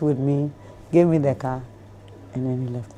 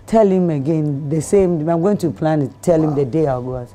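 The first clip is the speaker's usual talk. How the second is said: normal talking voice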